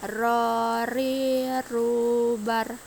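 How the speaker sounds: "Ro, ri, ru" drawn out with tongue-tip trill; "bar" closed on a trilled r. Shown as a demonstration. Teacher shows the letter ro, "Ro, ri, ru, bar" trilled and long. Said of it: "menyentuhkan lidah terdepan".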